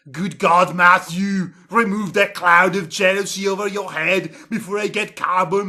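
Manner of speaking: half-shouted scolding; yelling. Acted. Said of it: "Mueller yelled".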